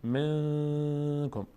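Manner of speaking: ن of من sent into the nose and held very long before کم; nasal ikhfa. Shown as a demonstration. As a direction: draw out a steady nasal hum in من, then release کم. (غنهٔ کشیده روی نون «من»، بعد «کم»)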